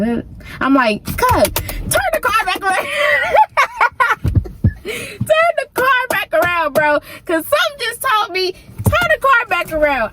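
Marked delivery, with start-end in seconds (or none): 1.0-2.9 high yelled order to turn back; frantic shout. Retold or imitated. Panicked shout at cousin to turn the car around. 2.9-4.8 high shrieking laugh. Real, not acted. Shrieking laugh while retelling the story. 4.8-10.1 high, urgent repeat of the order. Retold or imitated. Urgent inner voice telling her to turn the car back.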